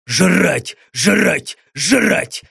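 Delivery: frantic pulsing demon chant; hysterical demon voice. Acted. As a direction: pound it out in a frenzy. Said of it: "истерически пульсировал в голове голос демона". (истеричный голос демона)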